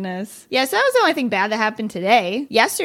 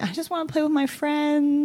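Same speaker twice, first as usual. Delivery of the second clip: put-on a light, higher little-kid whine; imitating a sobbing child; 7 semitones higher than usual. Retelling her son at dance class: a lightly voiced, higher whiny child. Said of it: "just sobbing because he's like".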